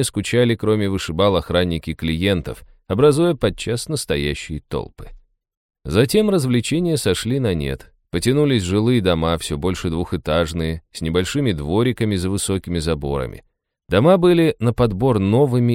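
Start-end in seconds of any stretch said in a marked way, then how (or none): none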